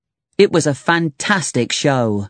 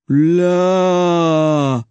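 slow; 3 semitones higher than usual. A narrator's reading. Neanderthal copying Larry's name, said slowly. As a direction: draw out the single word, slowly. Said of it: "he said slowly".

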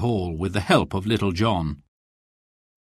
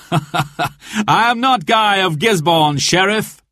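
triumphant laugh, then high, drawn-out taunt; gleeful, high-pitched mocking reveal; 8 semitones higher, 4 dB louder, slower than usual. A narrator's reading. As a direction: laugh at the foe you fooled. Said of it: "he laughed".

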